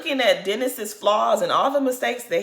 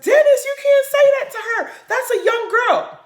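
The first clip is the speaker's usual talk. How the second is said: high, loud scolding outcry; group outcry; 6 dB louder than usual. Retold or imitated. Cast scolding Dennis for cursing at her, loud high outcry. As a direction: shout him down in shock, much higher and louder. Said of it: "And everybody's like".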